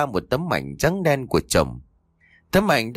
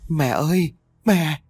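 narrated son's wail over the body; wailing for mother; 6 semitones higher, slower than usual. A narrator's reading. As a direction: cry out to the dead mother in grief. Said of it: "quỳ xuống mà gào thét vật vã".

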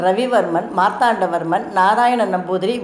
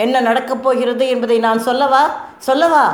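raised, higher-pitched cry building on the repeat; narrated shout; 4 semitones higher than usual. A narrator's reading. Kalyani challenges the arguing Namboothiri, shouting. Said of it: "என்று கத்தினாள் கல்யாணி".

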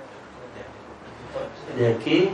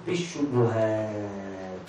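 slow answer; 9 semitones lower, 7 dB quieter, much slower than usual. Shown as a demonstration. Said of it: "كيف تدرأ الحدود؟".

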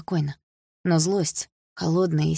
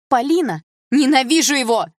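loud cry of hatred; shouted; 8 semitones higher, 7 dB louder than usual. A narrator's reading. Daughter screaming at her stepfather. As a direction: shout it out, furious. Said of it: "выкрикнула я".

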